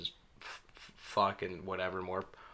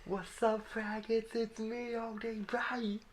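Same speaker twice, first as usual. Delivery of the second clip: very high, slow ghostly rapper voice; [name] ghost voice; 14 semitones higher, 3 dB quieter, slower than usual. Retold or imitated. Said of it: "ghost of [name] to come back and be like".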